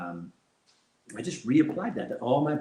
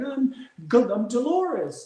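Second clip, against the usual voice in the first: put-on high, chirpy old lady; a lightly voiced grandma introducing herself; sweet grandma voice; 11 semitones higher, 5 dB louder, much slower than usual. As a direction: lift into a little grandma voice. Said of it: "she'd be like".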